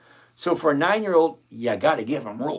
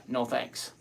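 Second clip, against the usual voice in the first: ordinary talk, nothing marked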